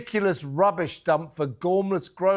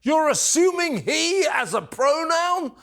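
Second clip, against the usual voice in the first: booming indignation; 9 semitones higher, 3 dB louder than usual. Retold or imitated.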